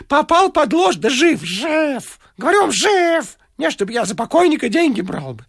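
put-on high fussy old man reading aloud; high, wheedling old man voice. A narrator's reading. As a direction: read the clippings like a sly old informer. Varfolomeich reads clippings.

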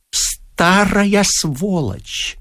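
very slow, drawn-out insult; hateful. A narrator's reading. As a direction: stretch each syllable with loathing. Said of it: "прошептал он с ненавистью".

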